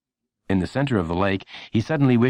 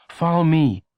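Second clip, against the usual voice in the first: higher, louder eager call; Lenny's voice; 9 semitones higher, 4 dB louder, slower than usual. A narrator's reading. Lenny leading Friend inside, eager. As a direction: lead him in like an eager boy.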